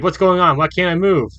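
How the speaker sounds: high, loud panicked cry; trapped boy panicking inside his own body; terrified. Acted. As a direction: scream it out trapped and scared. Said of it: "Voice sounds terrified".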